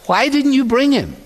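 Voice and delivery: high, sharp demand of the Pharisees; a Pharisee snapping at the officers, high and indignant. Retold or imitated. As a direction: bark the question up high like an outraged Pharisee.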